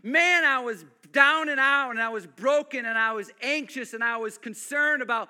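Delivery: loud, rising sermon climax; full-force preaching. Real, not acted. Pastor building to a loud climax before church. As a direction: preach it out to the back row.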